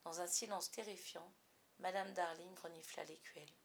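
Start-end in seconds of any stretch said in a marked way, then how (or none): none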